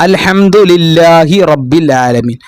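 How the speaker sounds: slow chanted tajweed recitation; Quran recitation. Shown as a demonstration. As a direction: recite it slowly like in prayer. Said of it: "അവിടെ ആ ഉണ്ടല്ലോ".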